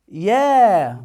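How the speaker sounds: يا with a long held vowel; stretched letter. Shown as a demonstration. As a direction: draw يا out, letting it fall at the end. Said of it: "نشد الحرف عشان كبره بقى طويل خالص". (مدّ طويل في يا)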